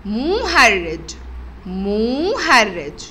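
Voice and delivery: slow drawn-out word. Shown as a demonstration. Teacher stretches مهرج slowly for the children. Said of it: "نشوف كده الشكل التاني".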